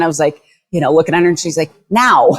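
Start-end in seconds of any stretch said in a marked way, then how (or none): 1.9-2.3 quick, higher-pitched command; retold firm order. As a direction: act out an urgent order. Store owner's urgent order, retold.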